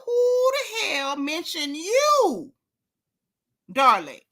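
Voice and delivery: high, drawn-out indignant question. Real, not acted. Commentator challenging a rapper who butted in, indignant. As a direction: stretch it out in high-pitched disbelief. Scolds rapper for inserting herself.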